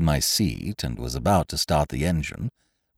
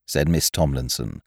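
ordinary delivery

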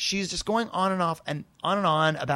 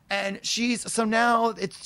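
normal talking voice

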